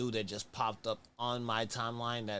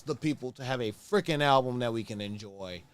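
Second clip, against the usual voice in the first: ordinary talk, nothing marked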